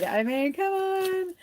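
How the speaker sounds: high drawn-out exasperated plea. Real, not acted. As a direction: rise high and stretch out the disbelief. Exclaims over piled-up astrological events.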